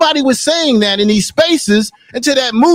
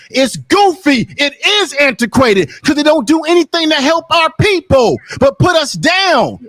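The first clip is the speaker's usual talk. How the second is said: real heated shout, pitched up high; raised, furious rant at a panel guest; angry shouting; 3 semitones higher than usual. Real, not acted. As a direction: shout him down in real anger. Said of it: "you got this mad about".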